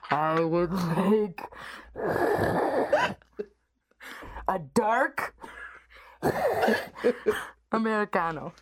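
slow, low masked villain; heavy breaths between halting phrases; Darth Vader. Acted. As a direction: order coffee as a slow, breathing dark lord. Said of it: "or Darth Vader? Can I go?".

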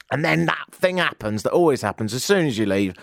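normal talking voice

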